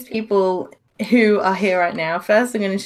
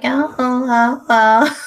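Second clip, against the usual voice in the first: put-on old grandma voice; 4 semitones higher, 5 dB louder, slower than usual. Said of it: "I sounded like an old grandma".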